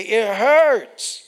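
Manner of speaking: loud, high cry of pain; hurting child. Acted. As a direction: cry it out loudly. Child crying out to God.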